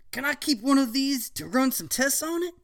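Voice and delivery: teacher voice. Acted. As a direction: ask like a keen scientist.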